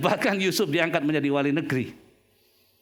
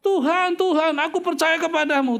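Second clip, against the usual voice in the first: crying out to God; 8 semitones higher than usual. Retold or imitated. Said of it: "Orang yang berseru-seru".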